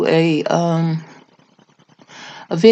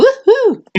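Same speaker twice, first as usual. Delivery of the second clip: joyful whoop; 6 dB louder than usual. Real, not acted. Celebrating a new grandchild.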